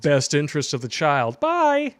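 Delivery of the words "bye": mocking bye. Mocking dismissal.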